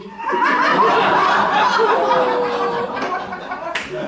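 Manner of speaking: big high-pitched laugh; high loud cackle. Real, not acted. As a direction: cackle loudly at the joke. Laughs at own punchline.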